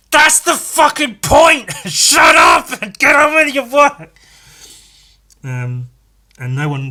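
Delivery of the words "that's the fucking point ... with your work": slow, much higher and louder roar; furious shout. Retold or imitated. Pupil turning to yell at a chatty classmate, furious shout. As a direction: blast it at her across the room.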